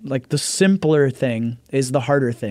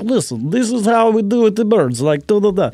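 higher, hammed-up Russian teacher voice; comic Russian accent, pitched up; mock Russian accent; 8 semitones higher, 3 dB louder than usual. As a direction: play a fake Russian bird trainer. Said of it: "trying to be Russian".

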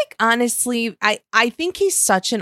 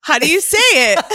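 higher, louder, hammed-up protest; mock outraged shout; 9 semitones higher, 8 dB louder than usual. Shown as a demonstration. To her teasing co-host, a mock-indignant loud shout. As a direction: throw the question back loudly in mock outrage. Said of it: "can you say pale again?".

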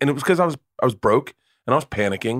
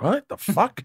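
much higher, baffled "What the fuck?"; stunned disbelief; 10 semitones higher, slower than usual. Retold or imitated. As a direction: throw it up high in mock shock. Dad stunned by daughter's refusal.

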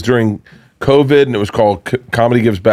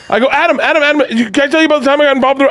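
panicking; 12 semitones higher than usual. Retold or imitated. Fan babbling a nervous college story at Adam Sandler, high and fast. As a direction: gush nervously at a rush. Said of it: "I'm fucking panicking".

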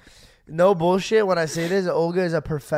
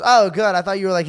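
loud tactless relief; 4 semitones higher, 9 dB louder than usual. Retold or imitated. Imitating friend's tactless relief.

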